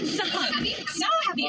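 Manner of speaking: excited. Real, not acted. Reunion hug at airport.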